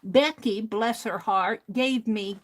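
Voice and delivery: ordinary delivery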